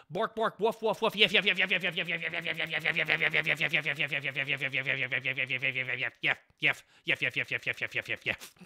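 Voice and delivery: playful yapping dog noises, yef yef; barking like dog. Pretend. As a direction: yap like a little dog. Streamer barks like a dog.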